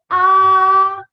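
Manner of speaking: AH held long on a high, level pitch; sustained high level AH; first tone. Shown as a demonstration. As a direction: stretch AH out loud as a flat high line. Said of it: "simply stretch the syllable".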